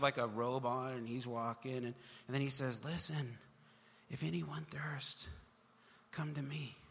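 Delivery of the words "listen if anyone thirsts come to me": soft, timid mock Jesus; a hint of a meek preacher; meek, weak Jesus. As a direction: say it like a shy weak man. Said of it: "That's how we think that he ministered".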